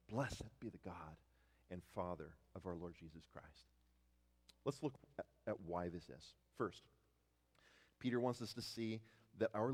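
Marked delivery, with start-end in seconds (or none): 0.1-3.7 low, soft, reverent praise; subdued declaration. Acted. Preacher echoing Peter amid suffering, quietly reverent. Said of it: "declare in the midst of your suffering".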